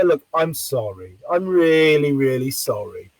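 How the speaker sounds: low, slow, earnest apology. Retold or imitated. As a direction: soften and drop the pitch to sound truly sorry. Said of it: "Why don't you apologize more real?".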